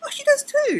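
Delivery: high, loud playful imitation of a friend agreeing; mock surprised squeal. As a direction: squeal it like a sudden realisation. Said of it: "And she goes".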